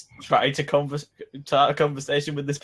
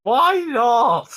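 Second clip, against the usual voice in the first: louder, higher demanding why; indignant shout; 8 semitones higher, 6 dB louder than usual. Acted. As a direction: shout back in protest. Arguing with hermit through chimney.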